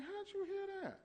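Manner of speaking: high, surprised question; mock surprise. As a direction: act caught off guard. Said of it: "I'm like".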